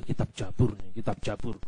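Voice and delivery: low, very soft murmur; hushed onlooker aside. Retold or imitated. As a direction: imitate onlookers murmuring to each other. Onlookers remarking among themselves.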